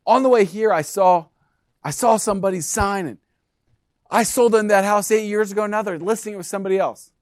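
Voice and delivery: mock Megan complaining; Megan impersonation. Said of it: "That's my Megan impersonation".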